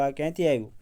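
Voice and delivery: plain reading voice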